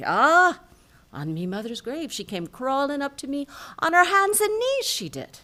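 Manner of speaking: high, loud, insistent Irish swearing it's true; Irish brogue, pleading on his mother's grave; rising Irish protest; Irish accent. Acted. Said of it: "my favorite Irish joke".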